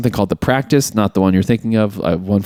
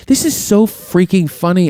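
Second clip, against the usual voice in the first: delighted; 7 semitones higher than usual. Retold or imitated. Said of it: "I was like".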